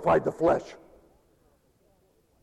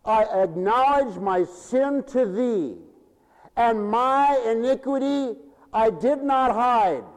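slow, raised psalm reading; scripture reading; 4 semitones higher, slower than usual. Acted. Preacher reading the psalm to the congregation, declaiming.

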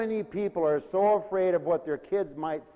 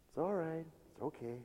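soothing parent; 11 dB quieter than usual. Retold or imitated. Soothing a crying baby.

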